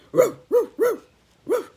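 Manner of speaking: loud, high yapping barks; high dog barks. Acted. Reader barking as the dog to children. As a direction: bark like an excited little dog.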